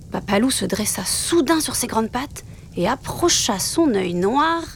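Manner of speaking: plain reading voice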